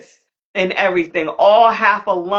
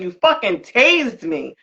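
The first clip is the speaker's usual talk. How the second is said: ordinary delivery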